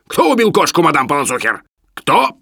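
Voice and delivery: high, loud accusing question; angry interrogation. Acted. Professor demands who killed the cat, furious. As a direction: demand the culprit, punching the question word.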